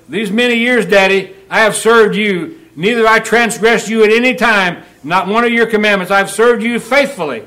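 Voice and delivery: sulky complaint to his father, pressed hard; older son griping about the party; bitter older son. Acted. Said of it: "Is he bitter?".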